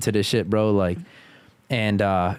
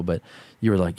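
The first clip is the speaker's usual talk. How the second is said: normal talking voice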